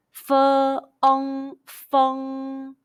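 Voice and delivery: "Fe, ong, feng" modelled slowly in pieces, high and level; high first tone drill. Shown as a demonstration. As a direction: hold "Fe", "ong", "feng" on one steady pitch for repetition. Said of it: "high pitch first tone all together".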